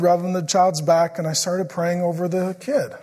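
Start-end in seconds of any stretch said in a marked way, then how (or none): none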